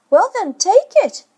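put-on high, loud boyish dare; child daring. A narrator's reading. Michael daring his father to drink, high child's voice. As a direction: challenge him like a small boy.

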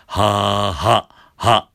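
loud ha with a firm glottal cut; voiced ha with stops. Shown as a demonstration. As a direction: voice the ha and clamp it shut. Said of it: "閉じる感じ".

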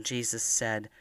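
usual speaking voice

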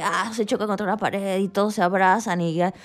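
ordinary talk

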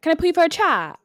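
high, loud British islander call; British Love Island accent. Retold or imitated. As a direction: call it out like a British reality contestant. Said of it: "my British accent is watching Love Island".

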